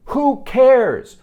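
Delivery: loud, high-pitched exasperated outburst. Real, not acted. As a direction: blurt it out, fed up with the nitpick. Ranting about a pointless change.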